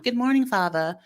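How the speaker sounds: a quick put-on British lilt to a father; British accent. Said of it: "I said that in an accent".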